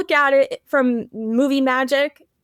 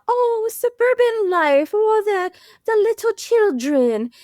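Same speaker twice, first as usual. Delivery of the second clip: high, affected cooing voice; Mary; 9 semitones higher than usual. Retold or imitated. Mistress in the car on suburban life, mock gushing. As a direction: gush in a put-on, higher-pitched voice. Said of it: "you know, Mary's like".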